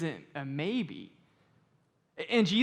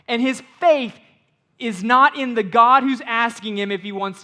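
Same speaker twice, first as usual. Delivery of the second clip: loud, higher, driving sermon voice; preaching at full force; 5 semitones higher, 12 dB louder than usual. Acted. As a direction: hammer the point home to the congregation.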